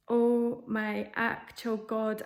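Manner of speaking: very slow, drawn-out dismay; stunned disbelief. Retold or imitated. Patient just told she is fat, stunned and slow. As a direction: stretch out every word in retold shock. Recalls her shock at insult.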